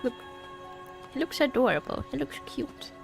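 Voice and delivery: normal talking voice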